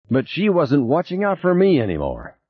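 high-pitched, aggrieved protest; angry complaint without extra volume. A narrator's reading. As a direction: protest the abandonment, pitch well up. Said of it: "why he was so angry".

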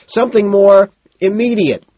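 high, loud reply; hollered answer; shouting back. A narrator's reading. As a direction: shout it back over the music. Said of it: "Price shouts back".

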